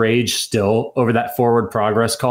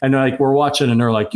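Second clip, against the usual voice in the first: ordinary talk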